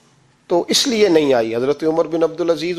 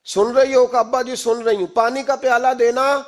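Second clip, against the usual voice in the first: higher-pitched, insistent call; 7 semitones higher than usual. Retold or imitated. Father calls his silent daughter a second time, calling out. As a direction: call out once more for an answer. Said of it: "پھر آواز دی".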